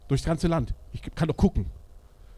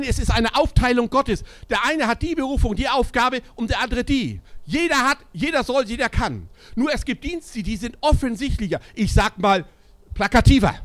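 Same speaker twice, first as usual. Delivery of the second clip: high, loud pulpit preaching; preaching at full force; 8 semitones higher, 6 dB louder than usual. Acted. Preacher rousing his congregation, ringing preaching voice. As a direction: drive each line home to the church.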